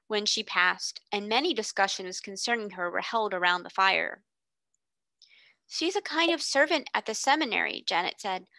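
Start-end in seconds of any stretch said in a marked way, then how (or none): 5.7-7.8 lightly voiced girl. Janet talks at the fire.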